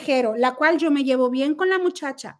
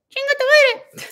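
mock angry retort; 12 semitones higher, 4 dB louder, slower than usual. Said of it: "le decía yo".

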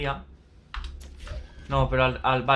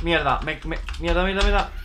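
frustrated cursing; 3 semitones higher, 4 dB louder than usual. Real, not acted. Cursing as enemies overwhelm him.

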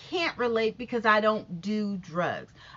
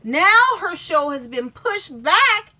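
higher, slow indignant reveal; 8 semitones higher, 7 dB louder, slower than usual. Real, not acted. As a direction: stretch it out in disbelief.

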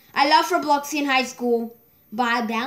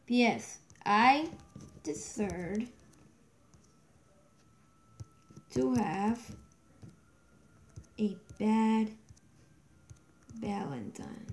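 slow, broken word by word, trailing softer; dictating while typing; 3 semitones lower, 10 dB quieter, slower than usual. Real, not acted. Player typing a message about a bad Valentine, dictating slowly. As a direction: murmur it in pieces with long pauses.

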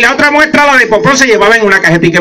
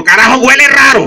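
grumpy grandpa; 7 semitones higher than usual. Acted. Grandpa voiced in comic story.

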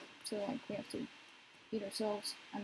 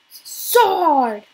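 loud, slow, falling It's so hard; a drawn-out exasperated shout; frustrated wail; 5 semitones higher, 19 dB louder, much slower than usual. Real, not acted. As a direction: wail it out at the screen.

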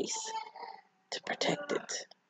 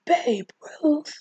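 high, incredulous echo of a name; shocked disbelief; 11 semitones higher than usual. Retold or imitated. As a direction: repeat it in stunned, high-pitched disbelief. Friends stunned at the name.

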